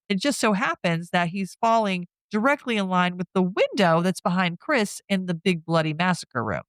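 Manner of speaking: ordinary talk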